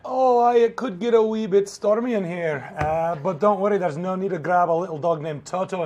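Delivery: put-on Scottish accent. Host teasing the stormy demo, in a Scottish accent. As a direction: ham up a Scottish brogue for the weather joke. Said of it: "Not sure why I had to do that in a Scottish accent".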